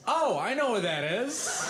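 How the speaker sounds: lightly voiced know-it-all. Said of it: "And then he goes".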